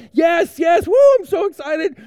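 bursting with overconfidence; mock excited. Pastor to kids, answering Charles on the rope, giddy and overexcited. Eager reply on the tightrope.

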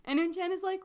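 normal talking voice

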